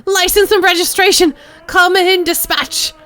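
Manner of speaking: high, loud frantic police callouts; megaphone scream. Acted. Crazed woman screaming at detectives through megaphone, high and loud. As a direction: blast each phrase like an alarm. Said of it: "then screams into the bloody mouthpiece".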